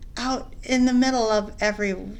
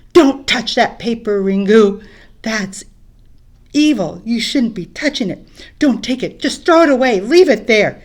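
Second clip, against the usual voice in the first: much louder, warning him off; urgent scolding; 11 dB louder than usual. Acted. Batu warning Ringu off the paper, loud scolding. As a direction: scold him to drop it, scared of it.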